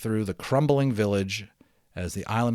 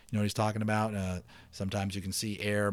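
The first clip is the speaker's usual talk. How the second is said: usual speaking voice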